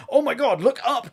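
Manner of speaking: hoog en luid, opgewonden roepen; excited call. Retold or imitated. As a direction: roep verbaasd naar boven. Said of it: "this comedic thing of them going".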